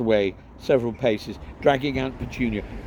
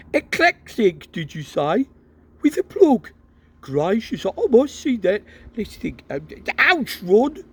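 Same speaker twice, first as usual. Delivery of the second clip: high, eager, fascinated by the plug; can barely contain his curiosity; excited father; 11 semitones higher, 6 dB louder than usual. Acted.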